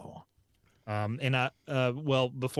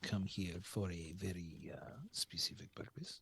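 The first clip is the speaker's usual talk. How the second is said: conspiratorial whisper in character; 3 semitones lower, 11 dB quieter than usual. Acted. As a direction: hush it like a secret. In-character secretive aside.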